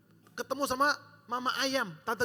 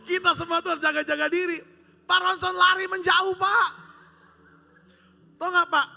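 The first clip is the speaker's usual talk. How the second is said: loud, higher pulpit shout; preaching shout; 6 semitones higher, 8 dB louder than usual. Acted. Preacher jokes Pak Ronson runs off, loud shout. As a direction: call it out across the church.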